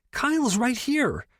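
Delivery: high, slowed, strained hiss of embarrassment; mortified shriek. Acted. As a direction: blurt it out to shut her friend up. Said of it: "Lo shrieked".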